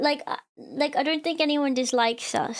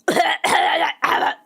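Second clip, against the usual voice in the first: mock cough; 4 semitones higher, 6 dB louder than usual. Imitating classmate Thomas always coughing.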